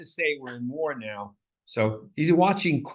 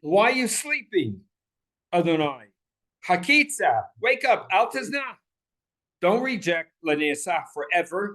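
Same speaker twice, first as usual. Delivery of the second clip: impassioned plea; 4 semitones higher than usual. Acted. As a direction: rouse God like someone asleep. Voicing the psalmist's plea to God.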